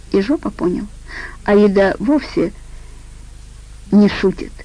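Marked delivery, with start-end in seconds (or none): none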